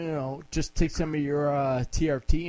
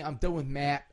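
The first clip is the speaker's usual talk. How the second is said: ordinary talk, nothing marked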